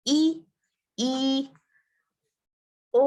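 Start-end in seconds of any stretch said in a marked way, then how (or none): none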